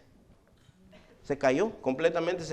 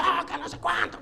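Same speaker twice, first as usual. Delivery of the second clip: high mock voice of a fussing woman; 10 semitones higher, 3 dB quieter than usual. Son imitating the fussing woman at his father's burial. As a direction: lightly imitate the woman causing a scene. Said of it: "una mujer haciendo un escándalo".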